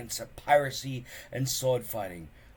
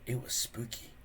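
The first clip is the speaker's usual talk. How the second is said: usual speaking voice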